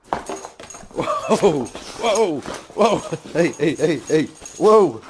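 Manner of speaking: high, loud repeated whoa; fending off an excited dog; startled whoas. Real, not acted. Dog knocks camera over.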